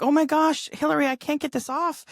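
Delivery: high, mock-panicked mother; imitating worried mom. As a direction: play a mother fretting over a stuck knot. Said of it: "my mom was really worried".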